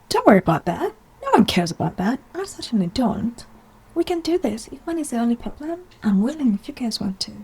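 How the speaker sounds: high-voiced mock eager persuading; playfully acted, brushing off objections; imagined Emerald. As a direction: pitch it up like a keen volunteer. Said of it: "Emerald walking by".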